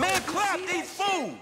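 high, slow shouted command; shouted order. Acted. Gangster shouting at his crew to shoot the enemies. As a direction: bark it out, drawn out, to open fire.

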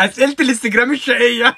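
high, loud, laughing it out; words squeezed through laughter. Real, not acted. Laughing at the cheeky Instagram questions, loud and high.

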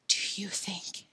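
breathless awed whisper. Acted. Boy whispers fearfully over the worm sandwich. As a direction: hush it, scared to ask.

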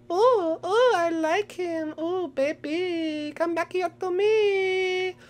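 swooning. Pretend. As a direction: melt over him, breathy and slow. Swooning over cute character.

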